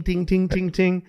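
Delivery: high, light chiming "ting"; glass tinging. Retold or imitated. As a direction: tap a glass for attention. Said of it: "he did a little ting".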